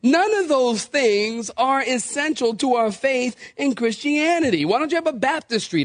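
normal talking voice

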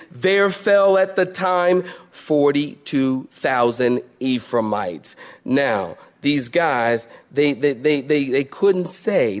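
ordinary delivery

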